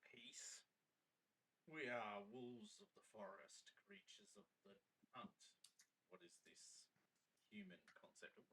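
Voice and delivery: hushed, menacing wolf lord; a predator toying with its guest. Acted.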